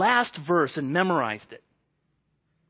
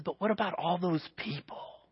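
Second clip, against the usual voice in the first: God's soft voice; 3 semitones lower, 8 dB quieter than usual. Retold or imitated.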